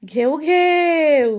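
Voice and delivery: slow drawn-out ghew ghew; dog bark. Shown as a demonstration. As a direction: bark slowly for the child like a dog. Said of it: "কুকুর কেমন করে ডাকে?". (ধীরে কুকুরের ডাক)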